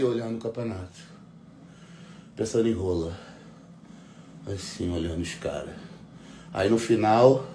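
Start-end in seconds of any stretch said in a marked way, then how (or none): 0.0-5.7 1 mock bored mumble. Bored crowd, quiet deadpan.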